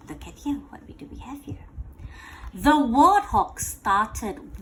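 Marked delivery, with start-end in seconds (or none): 0.1-1.6 soft, hushed discovery whisper; softer whispered wonder. Real, not acted. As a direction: whisper it like a secret find.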